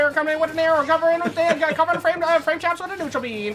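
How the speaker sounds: rapid auctioneer patter; fast high auction chant. Acted. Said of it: "I should have been an auctioneer".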